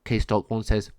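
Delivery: usual speaking voice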